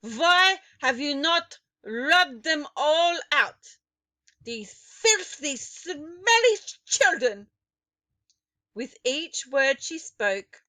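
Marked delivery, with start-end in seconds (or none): 0.0-7.5 put-on loud, high, slow, accented; screaming witch. A narrator's reading. Grand High Witch screaming at her witches about children. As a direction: drag out each word in loathing. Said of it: "she screamed".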